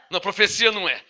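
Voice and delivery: ordinary talk, nothing marked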